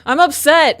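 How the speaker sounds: high, loud protest; mock yell of exasperation; retold upset outburst. Parent answering son's complaint about yelling, loud and upset. Retold shouting match with son.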